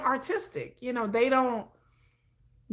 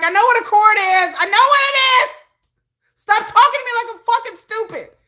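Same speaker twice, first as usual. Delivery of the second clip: higher and louder, fed up; exasperated outburst; 9 semitones higher, 6 dB louder than usual. Retold or imitated. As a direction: push back hard at being talked down to. Snapping back at condescending family.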